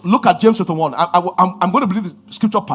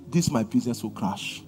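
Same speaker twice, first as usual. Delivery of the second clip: much softer and lower, like your own worried thought; inner voice; 9 semitones lower, 12 dB quieter than usual. Shown as a demonstration. Preacher showing the devil speaking as your own thought, soft and low. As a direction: murmur it to yourself as if the thought were yours. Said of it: "He will talk in your voice".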